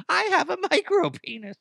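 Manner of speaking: a silly high-pitched guy proudly confessing; squeaky guy voice. Retold or imitated. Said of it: "Be like".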